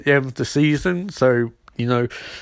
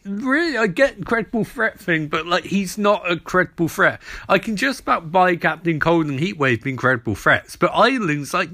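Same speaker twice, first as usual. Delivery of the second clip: ordinary talk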